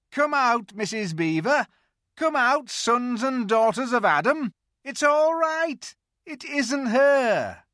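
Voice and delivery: high, loud, squeaky beaver shouting; a beaver calling his family out; excited beaver. A narrator's reading. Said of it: "how beavers talk when they're excited".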